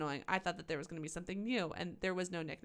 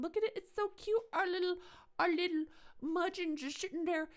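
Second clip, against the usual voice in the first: much higher, sing-song coo as if to a pet; cutesy baby talk; 11 semitones higher, 4 dB louder than usual. Acted. Gamer cooing at the creepy killer kid in cutesy baby talk. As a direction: gush over him like an adorable kitten.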